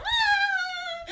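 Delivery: piercing high, very loud scream; child's scream. Retold or imitated. Mom imitating her son screaming at Voldemort, high and loud. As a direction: shriek like a terrified little kid. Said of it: "he screamed bloody murder".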